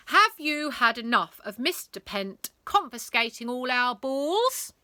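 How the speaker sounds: loud rebel rouser; rallying cry. Acted. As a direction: whip up the crowd. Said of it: "she cried".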